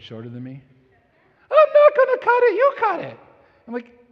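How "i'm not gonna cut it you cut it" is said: high, loud mock wife voice; hammed-up high wife voice; imitating wife. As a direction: squeak it like his wife refusing. Husband voicing his wife.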